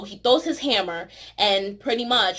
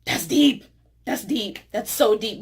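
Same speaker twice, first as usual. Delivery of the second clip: awed. Retold or imitated. Vlogger reacting to Odin's advice, awed. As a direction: savor the wisdom. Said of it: "I was like".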